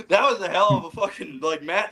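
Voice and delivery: high, laughing through the words. Real, not acted. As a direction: still cracking up. Laughing over the chaos.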